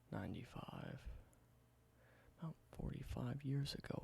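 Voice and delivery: soft, close whispered ASMR roleplay. Acted. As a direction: whisper it gently like showing an old relic. Whispered ASMR show-and-tell roleplay.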